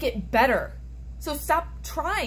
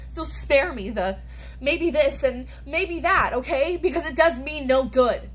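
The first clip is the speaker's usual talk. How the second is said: loud, high and furious; angry outburst; 4 semitones higher, 4 dB louder than usual. Acted. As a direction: snap it at her, building to a shout. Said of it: "It's a monologue from These Shining Lies".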